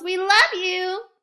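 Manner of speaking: high, loud loving yell. Acted. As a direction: shout it happily to the teacher. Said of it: "Yolanda yells".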